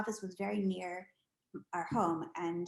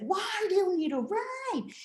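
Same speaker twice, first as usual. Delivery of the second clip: high, slow, irritated; mother annoyed at being interrupted; mock exasperation; exasperated mom; 11 semitones higher, 5 dB louder than usual. Impatient mom to son.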